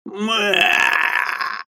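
high drawn-out groan of pain; pained mooing groan. Acted. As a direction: moan like a kicked bull. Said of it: "замычал он".

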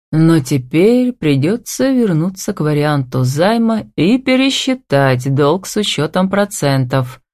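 plain reading voice

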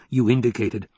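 usual speaking voice

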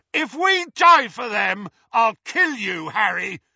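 furious roar, high and loud. A narrator's reading. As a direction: roar it over the fire at Harry. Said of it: "roared Ron's voice".

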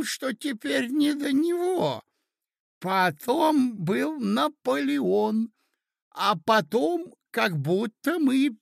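very high, slow doddering voice; befuddled old prince. Acted. Old prince obligingly recalling events for his nephew, befuddled. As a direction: strain to remember, dragging out the words.

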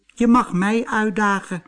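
very high, light mouse voice; little mouse. Acted. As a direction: squeak it out as a tiny mouse.

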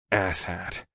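soft muttered insult; said to nobody. A narrator's reading. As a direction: grumble it quietly to yourself. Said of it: "I said to nobody in particular".

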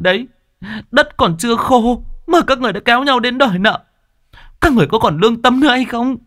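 high-pitched, tearful accusation; tearful outburst. A narrator's reading. Grieving daughter confronting the debt collectors, tearful outburst. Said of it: "mà nói trong nước mắt".

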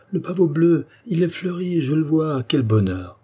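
high, elated cries easing at the end; overjoyed exclamation. A narrator's reading. Old godfather seeing his blue poppy bloom, overjoyed.

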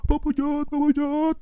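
high anxious cries; panicked cries. Retold or imitated. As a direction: fuss in a rising panic. Panicked father and child.

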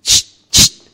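loud hushing shh; urgent shush. Acted. Shopkeeper hushing customer before a secret, sharp shush. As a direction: hush him sharply.

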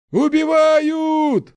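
very high, long, loud scream; panicked scream. Acted. Townsperson alerting the fleeing crowd, panicked scream. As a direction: scream it out in terror.

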